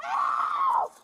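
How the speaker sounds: desperate screams for help; shrieking high for help; terrified horror victim. Real, not acted. Woman screams for help during arrest.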